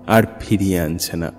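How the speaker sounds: normal talking voice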